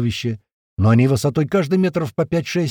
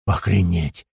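low, dumbstruck murmur; barely manage to get it out; stunned utterance; 7 semitones lower, slower than usual. A narrator's reading. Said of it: "только смог вымолвить Большой". (ошеломлённо, низким голосом)

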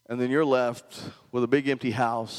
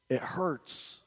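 hushed ache; 9 dB quieter than usual. Retold or imitated. Pastor tells wife about hurting people.

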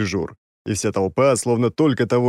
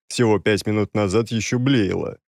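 ordinary delivery

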